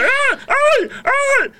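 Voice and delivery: shrill high mock cries of pleasure; high mock moan. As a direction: cry out high like an excited woman. Imita los gritos de la mujer. (gemido agudo imitado)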